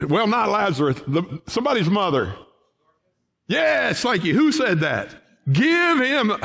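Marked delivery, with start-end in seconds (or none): 5.5-6.3 high, loud called-out answer; mock shout from the pews. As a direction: shout it back like someone in the congregation. Quoting a congregant's shout.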